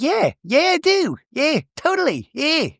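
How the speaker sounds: very high, giddy yes-man; hammed-up high-pitched enthusiasm; mock eager captain. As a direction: squeak out eager agreement. Voices captain replying to flirting.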